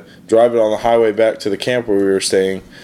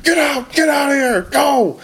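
high frantic yell to get out; frantic shout. Retold or imitated. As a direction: yell at him to get out now. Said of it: "he's like".

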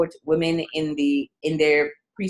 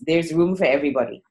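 ordinary delivery

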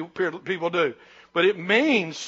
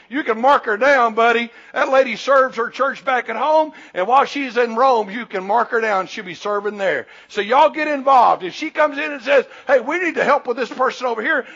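preaching; 3 semitones higher, 4 dB louder than usual. Acted. Preacher paraphrasing Paul, louder.

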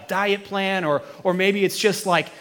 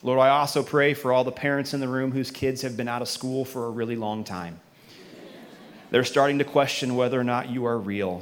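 low, soft closing prayer; 7 semitones lower, 6 dB quieter than usual. Acted. Pastor praying over parents in the room, soft prayer voice. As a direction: pray softly over the parents.

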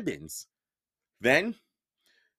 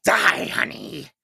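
slow, louder monster snarl; 6 dB louder, slower than usual. Acted. Monster teacher attacks Percy, slow louder snarl. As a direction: snarl it slowly as she lunges. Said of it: "She snarled".

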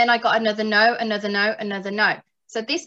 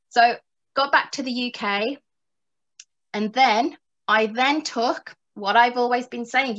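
ordinary talk

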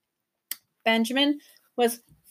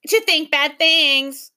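higher, slow mocking echo; echoing creature; 5 semitones higher, 4 dB louder, slower than usual. A narrator's reading. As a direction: parrot the girl's words. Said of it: "echoed the gaboon".

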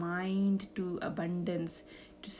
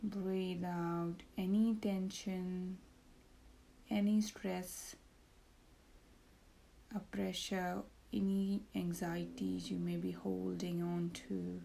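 soft, slow meditation voice; calm, hushed, lead them into relaxation; guided meditation; 8 dB quieter than usual. Acted.

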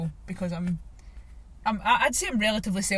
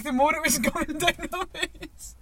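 laughing through the words; half-laughing at her own tears; 8 semitones higher than usual. Real, not acted. Telling her friend about crying mid-session, laughing.

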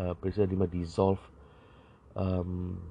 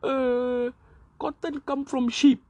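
much higher, louder, in a silly dim voice; mock idiot voice, pitched way up; dumb politician; 5 dB louder, slower than usual. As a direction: play a clueless MP spouting nonsense. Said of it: "telling things like".

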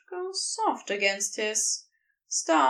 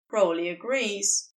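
ordinary delivery, nothing marked

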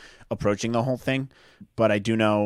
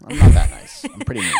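mock menacing; 12 dB louder than usual.

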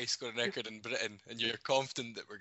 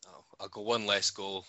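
ordinary talk, nothing marked

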